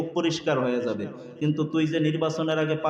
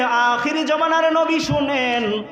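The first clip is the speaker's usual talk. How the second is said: high, drawn-out preacher's call; 10 semitones higher, 4 dB louder, slower than usual. Retold or imitated. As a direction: call out slowly as Idris appealing to the Prophet. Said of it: "ডাক দিয়া বলতেছে". (উঁচু গলায় টেনে টেনে ডাক দেওয়া)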